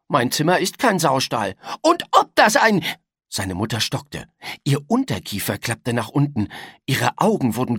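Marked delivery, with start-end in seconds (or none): 0.1-2.8 rising, louder indignant protest; a boy huffily sticking up for himself; defiant boy. A narrator's reading. Boy protests to his mother, getting louder.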